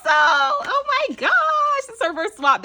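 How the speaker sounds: happy squeal. Real, not acted. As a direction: stretch it out in pure delight. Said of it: "that makes me so happy".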